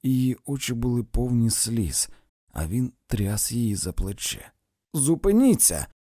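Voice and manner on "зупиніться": loud, raised command; exclaimed. A narrator's reading. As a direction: cry it out right into someone's face. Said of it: "вигукнув він їй просто в обличчя". (вигукуючи просто в обличчя)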